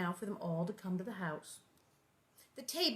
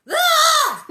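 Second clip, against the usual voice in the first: shrill loud scream; girl's horrified shriek; 19 dB louder than usual. A narrator's reading. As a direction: let out a piercing shriek. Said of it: "then she screamed".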